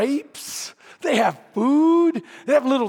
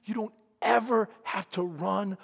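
softer, slow, choked-up father; tender, holding back tears; tearful father; 3 semitones lower, 7 dB quieter, slower than usual. Retold or imitated. Father hugging his runaway son, softly tearful. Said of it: "with tears coming down his eyes".

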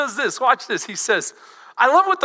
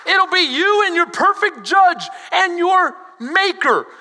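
usual speaking voice

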